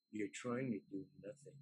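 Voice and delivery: very soft, low retold aside; imitating a director's private note; quiet, personal. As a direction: drop right down, just between two people. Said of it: "quietly and personally".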